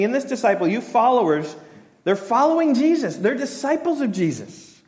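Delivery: Jesus addressing followers. Retold or imitated. Jesus addressing his followers, high and building. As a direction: build it up like a preacher calling out. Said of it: "he's saying".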